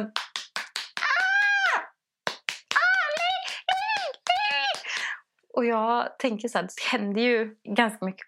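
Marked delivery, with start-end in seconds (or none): 0.0-4.9 very high, strained calling out; mock shouting from afar. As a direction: wail it like a far-off cry. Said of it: "hör jag i bakgrunden". (härmad hög rop på avstånd)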